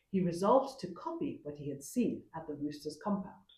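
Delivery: ordinary delivery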